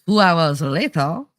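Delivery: slow, put-on French accent. Reading Outpost's comment to the stream, in a French accent. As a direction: stretch it out in a mock French accent. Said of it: "in a French accent".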